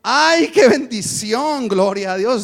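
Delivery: high, loud ecstatic praise; joyful exclamation. Retold or imitated. Congregant waking up, shouting praise with joy. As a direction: cry out blessing like waking up overjoyed.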